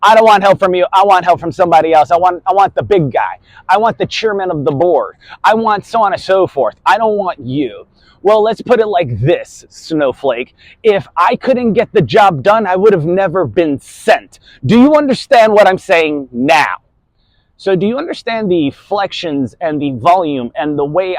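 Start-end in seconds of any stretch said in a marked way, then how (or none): 0.0-7.9 stubborn refusal. Retold or imitated. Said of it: "all of a sudden they say". 8.2-16.8 a louder, commanding drill-instructor edge; aggressive, then authoritarian. Shown as a demonstration. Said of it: "came off as somewhat aggressive in the beginning".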